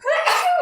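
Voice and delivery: sudden high sneeze; a quick involuntary sneeze; real sneeze. Real, not acted. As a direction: sneeze mid-thought. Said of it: "had a sneeze".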